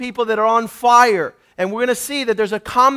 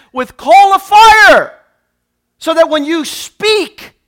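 preaching climax; 4 semitones higher, 6 dB louder, slower than usual. Real, not acted. Preaching climax on Isaiah's coal.

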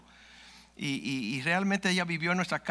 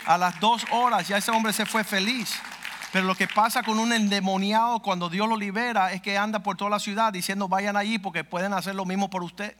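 louder, raised-pitch preaching voice; fervent preaching; 3 semitones higher, 4 dB louder than usual. Acted. Pastor preaching to the congregation, fervent and raised. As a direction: preach it with rising fervor.

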